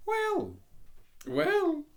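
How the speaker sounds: prim little duck voice, high. Acted. The Duck placating.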